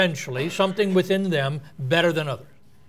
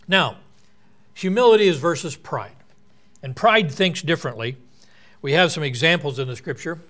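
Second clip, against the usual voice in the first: normal talking voice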